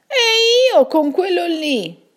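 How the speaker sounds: high put-on voice, close to crying; Raggio retorting. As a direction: whine back in tearful protest. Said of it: "ribatté Raggio".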